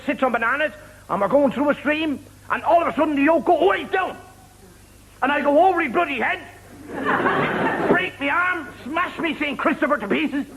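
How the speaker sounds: high-pitched aggrieved buyer; indignant complaint. Acted. Cheated buyer confronts horse seller, acted indignation.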